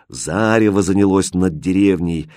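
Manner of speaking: plain reading voice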